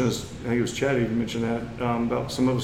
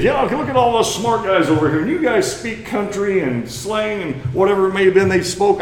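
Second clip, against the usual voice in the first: sneering mockery; 7 semitones higher, 4 dB louder than usual. Sneering townsmen mock country folk.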